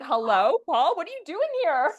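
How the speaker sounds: mock surprised greeting. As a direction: greet him with teasing surprise. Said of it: "I was like".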